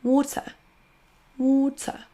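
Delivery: British water with an uh ending. Shown as a demonstration. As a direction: say water with a dropped final r. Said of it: "se prononce avec un son schwa à la fin".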